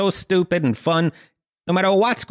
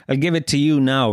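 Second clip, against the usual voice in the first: ordinary talk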